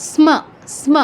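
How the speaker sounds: "স্ম" as sma; touch the s, open fully on m in "স্ম"; touched s, full m. Shown as a demonstration. Teacher demonstrating the cluster, "স্ম" as sma. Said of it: "touch করে ম এর পূর্ণ".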